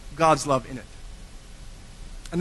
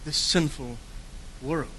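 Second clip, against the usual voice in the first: quiet, slow and weighty, falling softer to world; hushed true reading; 3 semitones lower, 9 dB quieter, slower than usual. Shown as a demonstration. Preacher softens to give the true verse. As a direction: let the real verse land softly and gravely. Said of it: "It says".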